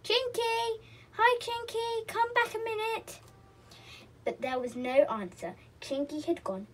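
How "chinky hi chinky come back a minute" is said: high, sustained call; sing-out holler, much higher. A narrator's reading. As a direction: call someone back from the doorway. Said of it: "He ran to the door and called".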